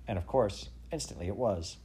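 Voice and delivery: ordinary delivery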